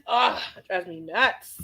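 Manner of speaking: ordinary delivery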